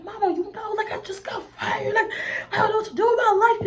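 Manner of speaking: high, tearful, breaking voice; re-live the sobbing call to mom; retold crying. Telling her mama she got fired, high and tearful.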